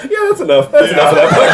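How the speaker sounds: high, loud, laughing protest; laughing surrender. Real, not acted. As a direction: give up the book, cracking up. Reader cracking up.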